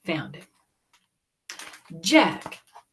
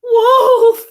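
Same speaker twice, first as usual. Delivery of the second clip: high, loud shout; crying out; 17 dB louder than usual. Acted. Jack crying wolf at the lazy birds. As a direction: sound the alarm full force. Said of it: "he cried".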